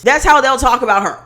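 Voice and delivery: ordinary talk, nothing marked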